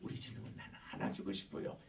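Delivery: put-on soft, fast whispered child's voice; lightly imitated child; child's wish. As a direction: hush it like a child confiding. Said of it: "아, 우리 예수님께서".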